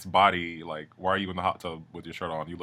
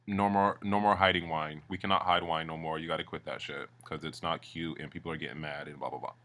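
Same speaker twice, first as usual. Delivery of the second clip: low scolding; 4 semitones lower, 7 dB quieter than usual. Retold or imitated. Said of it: "he's like".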